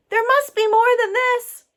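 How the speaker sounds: high, loud exclamation; exclaimed. Acted. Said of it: "Wu Kang exclaimed".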